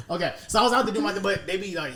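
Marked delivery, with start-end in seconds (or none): none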